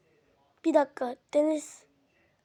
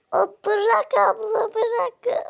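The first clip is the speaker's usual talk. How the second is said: drawn-out, raised wail; pained pleading; 4 semitones higher, 3 dB louder, much slower than usual. Acted. Child begging big sister to let go, pained wail.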